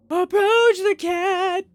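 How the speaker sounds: very high, loud, slow squeal of a command; gleeful drawn-out squeal; excited shout. Acted. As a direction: shriek it like the cat is the best thing ever. Excitedly declares action to approach cat.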